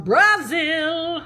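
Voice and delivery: Brazil drawn out with a sung flourish; showy Brazil. Real, not acted. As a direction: sing out "Brazil", stretching both syllables. Hyped pronunciation of country name.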